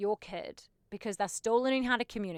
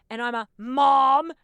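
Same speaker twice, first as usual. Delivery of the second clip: louder, slowed right down; mock confession; 4 semitones higher, 11 dB louder, slower than usual. Admitting to viewers she's a mom, mock dramatic. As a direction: drag out the dreaded word mom. Comic admission of being a mom.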